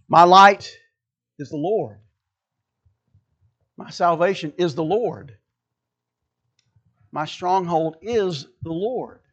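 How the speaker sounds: deliberate phrases, pausing before or on "is the Lord"; reversed for emphasis. Shown as a demonstration. Preacher offering congregation another way to read the verse, deliberate emphasis. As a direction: set out each phrase, then settle on "the Lord". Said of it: "You could say it this way".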